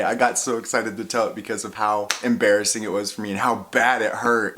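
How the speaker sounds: ordinary talk, nothing marked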